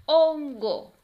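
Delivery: loud, high "Hon-go" in two beats; teacher segmenting "Hongo" for children; syllable by syllable. Shown as a demonstration. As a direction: punch each syllable of "Hongo" separately. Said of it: "vamos a separar cada una".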